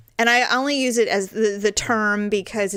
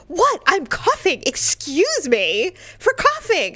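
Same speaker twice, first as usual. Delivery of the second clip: high-pitched mock-indignant retort; indignant husband; 9 semitones higher, slower than usual. Husband defends his coughing to his wife, mock indignant. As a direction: play the affronted husband, voice pushed way up.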